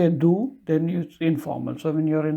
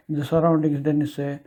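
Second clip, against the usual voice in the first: ordinary delivery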